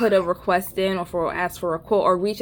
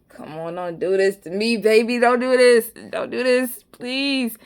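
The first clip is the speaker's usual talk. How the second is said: mock pleading; 3 semitones higher than usual. Homeowner begging plumber over old water heater, mock pleading. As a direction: beg him, building from usual voice to raised plea.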